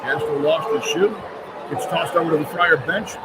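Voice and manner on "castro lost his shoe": ordinary delivery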